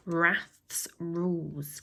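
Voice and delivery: a hushed, slow whispered title. A narrator's reading. Narrator whispers the chapter title to listeners, slow and hushed. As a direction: breathe the title out slowly. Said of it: "whispered chapter title".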